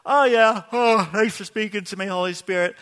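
casual mock gratitude to the Holy Spirit; offhand mock thanks. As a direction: toss off the thanks like it's nothing. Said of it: "I don't wanna just".

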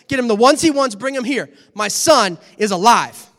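a father bursting with joy, giving orders; excited father. Acted. Father ordering servants to prepare the feast, excited.

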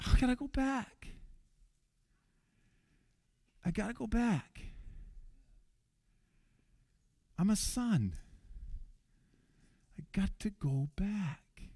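the son decides to go home, hushed; quiet resolve. Retold or imitated. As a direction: say it softly to himself.

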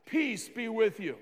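usual speaking voice

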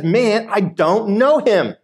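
acted indignant protest; emphatic denial. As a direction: stretch out a heated denial. Voices Peter's final denial.

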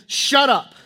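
high, loud order to silence; forceful command. Acted. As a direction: rebuke a demon sharply. Preacher voices Jesus ordering the demon.

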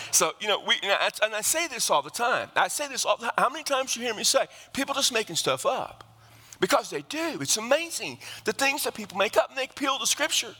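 high, driving preacher's cadence at full force; fiery preaching. Acted. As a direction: preach it hard, rising over the congregation.

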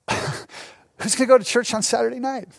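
imitated scoffer, incredulous; mock disbelief after a laugh; laughing mockery. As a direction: toss it out as a derisive question. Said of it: "they would laugh at me".

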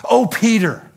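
high, loud exasperated cry; loud, high-pitched chiding; another reproachful way. Shown as a demonstration. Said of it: "Let me try it a different way".

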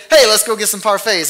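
bright, eager invite to friends; cheerful invitation. Retold or imitated. Preacher playing a friend inviting people for parfaits, excited. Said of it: "you say".

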